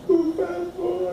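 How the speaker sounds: put-on high, slow little-girl "por favor"; tiny child's please. Father voicing his daughter finally saying please, high and slow. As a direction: say it shyly like a small girl giving in.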